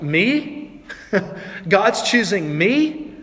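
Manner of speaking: mildly disbelieving, with a slow, questioning lift; can't believe it is you; incredulous. Acted. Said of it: "she's probably thinking".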